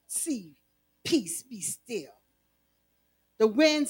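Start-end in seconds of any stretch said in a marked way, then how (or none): 1.0-2.1 soft, lower voice stilling the sea; hushed command to the sea; calm rebuke. Retold or imitated. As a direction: quietly rebuke the storm. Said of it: "he rebuked the wind and said".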